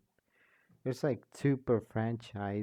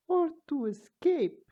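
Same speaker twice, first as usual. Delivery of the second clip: much higher and louder burst; high excited character; 5 dB louder than usual. Acted. A new character cutting in about escaping, high and loud. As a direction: pop in brightly.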